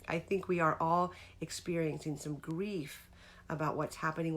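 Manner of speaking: ordinary talk, nothing marked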